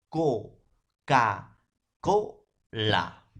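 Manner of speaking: each syllable separate: "co", "ca", "co", "la". Shown as a demonstration. Teacher giving students example syllables, syllable by syllable. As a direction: punch out "co, ca, co, la" with gaps. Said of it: "Por ejemplo".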